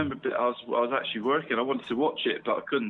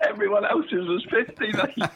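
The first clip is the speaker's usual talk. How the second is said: laughing; 6 semitones higher than usual. Real, not acted. Laughing at his own story.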